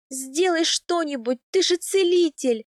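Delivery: high, loud desperate plea; pained cry. A narrator's reading. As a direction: cry out through a contraction. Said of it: "закричала я при очередной схватке".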